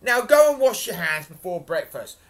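loud, bossy order; scolding. A narrator's reading. Giantess sending her husband to wash, scolding. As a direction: order him off like a nagging wife. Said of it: "the giantess scolded".

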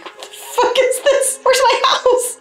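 put-on high, breathy-light child voice, laughing through; mock little girl voice. Little Susan finding no house under the tree, mock-kid outrage. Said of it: "She's like".

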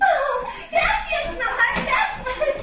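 high, loud, slow laughing gratitude; loud delighted girl's thanks; overjoyed thanks. Acted. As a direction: gush thanks with joy. Girl thrilled at news.

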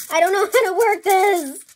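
frustrated outburst. Real, not acted. Sprayer fails, getting frustrated.

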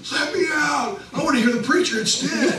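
high, loud, laughing mock plea; mock impatient crowd. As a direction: ham it up like people begging to be let out. Joking voice of impatient people.

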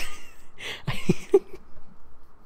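high breathless giggle; can't stop giggling; real giggle fit. Real, not acted. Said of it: "I'm, I'm giggling".

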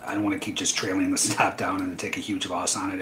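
ordinary talk

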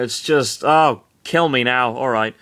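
usual speaking voice

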